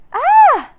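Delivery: high, very loud scream; girl screaming at a monster. A narrator's reading. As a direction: shriek in fright. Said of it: "screamed Annie".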